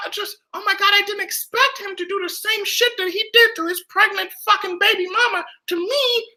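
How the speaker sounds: high, whiny voice of a self-pitying celebrity; mock Khloe voice. Voicing Khloe's self-pitying thoughts in a high whiny voice. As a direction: play her as a spoiled diva wailing.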